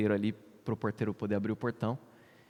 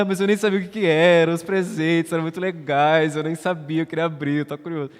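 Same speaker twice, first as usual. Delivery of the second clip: put-on tearful child's whine; 3 semitones higher, 6 dB louder than usual. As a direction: whimper it like a kid who never got to open his gifts. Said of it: "eu não tava falando nada disso".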